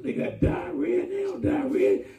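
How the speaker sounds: high, hollering exclamation; mock shout. Preacher mocks what coworkers say, hollered high. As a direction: holler it like you're joking with the church. Mocking coworkers about bathroom praise.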